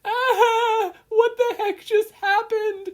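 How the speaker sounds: very high, loud panicked scream. A narrator's reading. Izuku screams as he is thrown across the course. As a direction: scream while flying through the air. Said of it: "he screamed".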